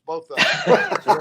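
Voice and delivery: laughing. Real, not acted. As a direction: chuckle it out slowly. Teasing fighters as wimps.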